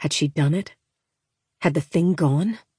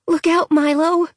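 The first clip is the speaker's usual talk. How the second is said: put-on young girl calling out; 12 semitones higher than usual. Girl calls to absent brother.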